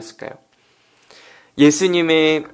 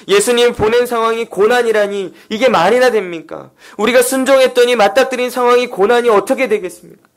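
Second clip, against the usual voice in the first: high-pitched, insistent indignation; aggrieved protest; 7 semitones higher, 4 dB louder than usual. Acted. Disciples demand why Jesus sent them into hardship, aggrieved protest. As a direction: pile up wronged questions, acted as the disciples. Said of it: "제자들이 얼마나 억울했겠습니까".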